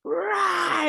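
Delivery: high mock rage roar; angry roar. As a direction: let out a short Darth Vader-style growl of fury. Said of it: "I didn't know I had hate or anger in me".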